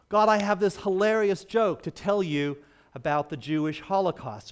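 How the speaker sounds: eager joke teller. Retold or imitated. Old man pitching joke to God.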